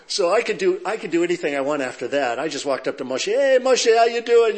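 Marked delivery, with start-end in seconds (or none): 3.3-4.6 high, loud, cheery hello; breezy loud greeting. Retold or imitated. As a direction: call out like to an old buddy. Casual greeting to minister retold.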